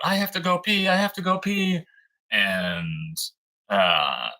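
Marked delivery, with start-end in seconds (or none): 0.1-1.8 high childlike mild mock yell; imitating child yelling. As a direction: mimic a kid's urgent plea, high-pitched. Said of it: "Bella screams out".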